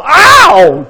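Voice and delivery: sharp high yelp of pain, hammed-up. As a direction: cry out as you hit the mat. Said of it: "Jim winced".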